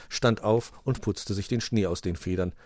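ordinary delivery

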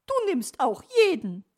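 loud, high, offended magpie; slightly miffed. A narrator's reading. Magpie sees fox accepted too, high miffed voice. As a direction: complain in a high, put-out voice. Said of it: "ein bisschen verstimmt".

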